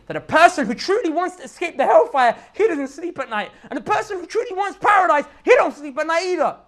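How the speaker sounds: high, loud exhortation; impassioned preaching. Real, not acted. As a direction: preach it at full force, voice climbing. Preacher exhorts at full force.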